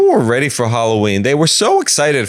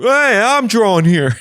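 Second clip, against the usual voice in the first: 1 neighbor voice; 10 semitones higher than usual. Acted. Neighbor voiced through wall.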